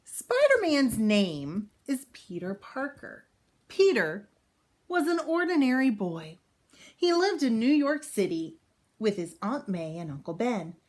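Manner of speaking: bright, clear storybook read-aloud; reading to children. Acted. Teacher reading a Spider-Man book to kids, storybook voice. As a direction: read it out for young listeners.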